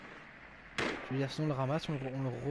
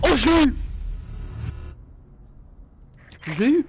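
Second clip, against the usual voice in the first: high loud victory yell; triumphant shout; 10 semitones higher, 6 dB louder than usual. Real, not acted. Scores a kill.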